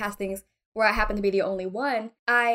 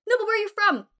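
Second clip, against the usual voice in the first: much higher, pushy question; put-on nosy persistence; mock prying insistence; 10 semitones higher, faster than usual. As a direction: press for the real answer. Voicing someone pressing about origins.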